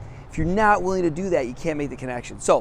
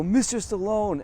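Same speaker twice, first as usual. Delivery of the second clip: higher-pitched call for attention; lightly raised, hailing voice; polite calling out; 6 semitones higher, slower than usual. Retold or imitated. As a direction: hail him politely, pitched up. Calls star by corrected name.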